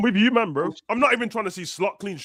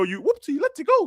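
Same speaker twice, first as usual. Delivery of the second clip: much higher mocking squeak; mocking squeal; 10 semitones higher than usual. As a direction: go up high to mock him. Mocking the Scouse player.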